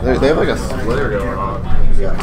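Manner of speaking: ordinary talk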